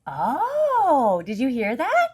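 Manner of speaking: ordinary delivery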